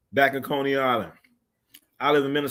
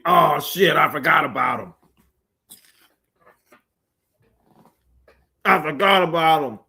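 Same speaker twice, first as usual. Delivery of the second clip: surprised shout; 3 semitones higher, 5 dB louder than usual. Real, not acted. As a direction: shout it as it comes back to you. Suddenly remembers World B. Free.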